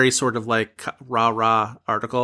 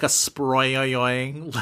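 slow stretched sproyoyoing noise; mock sound effect; 3 dB quieter than usual. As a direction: draw out a springy sproyoyoing. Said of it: "like, sound effect".

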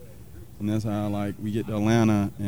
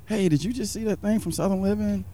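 wife's voice pitched higher, eager question; higher-pitched imitation of an excited wife; imitating wife; 11 semitones higher than usual. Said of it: "She's like".

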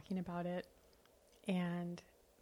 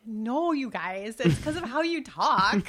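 amused exclaim; 4 semitones higher, 9 dB louder, slower than usual. Retold or imitated. Retelling her own reply, laughing.

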